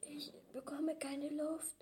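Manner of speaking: whisper, squeezed for air; breathless whisper. Acted. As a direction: gasp it out, can't breathe. Kid squeezed in a hug. (atemloses Flüstern)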